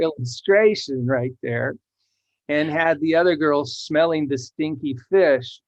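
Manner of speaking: usual speaking voice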